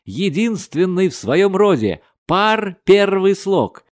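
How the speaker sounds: high, loud, triumphant declaiming; joyful yell. A narrator's reading. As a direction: proclaim it slowly and proudly. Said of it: "заорал он необыкновенно радостно". (радостно и громко провозглашая)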